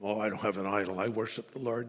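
mock denial. Preacher imitating a listener denying any idol, low and soft. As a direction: brush it off like it's obvious. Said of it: "there may be an idol".

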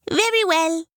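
high monkey voice; high kindly monkey. Acted. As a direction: agree in a high little voice. Monkey agrees.

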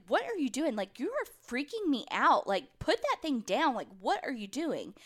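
retold alarmed protest, pitch jumping high; freaked-out sister. Sister telling brother to put the gun down, freaked out.

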